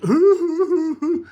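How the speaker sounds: high, loud wailing "hoo-hoo-hoo"; exaggerated imitation of uncontrolled sobbing; mock bawling. Demonstrating how the woman bawled, mock bawling. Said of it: "bawling. I mean, like".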